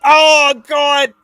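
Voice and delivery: high, loud cry of alarm; shouted outcry. Real, not acted. Gamer caught out mid-match, high shouted outcry. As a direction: yell it high, holding each word. Sudden outcry during the game.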